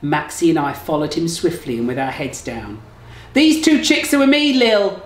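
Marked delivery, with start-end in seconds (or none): 3.3-5.0 much higher, louder camp call. Acted. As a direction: call across to the booth. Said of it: "Jeanette shouted".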